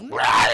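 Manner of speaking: loud mock monster roar; sudden loud "rah". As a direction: roar like a cartoon shadow beast. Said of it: "the shadow monster goes".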